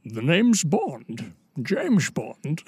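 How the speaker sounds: slow, lightly voiced Scottish Sean Connery; a hint of Connery's Scottish drawl, slowly; Sean Connery impression. Trying a Sean Connery impression for friends, slow Scottish. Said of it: "I can do an okay Sean Connery".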